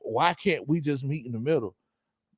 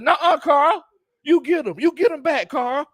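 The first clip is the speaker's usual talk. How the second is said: high mock voice; 13 semitones higher, 3 dB louder than usual. Retelling a Last Dance line.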